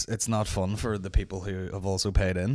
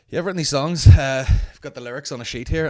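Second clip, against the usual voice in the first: louder, higher breezy musician voice; careless singer; 5 semitones higher, 9 dB louder than usual. Retold or imitated. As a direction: shrug it off like a careless songwriter. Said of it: "just like".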